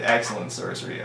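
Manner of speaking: ordinary talk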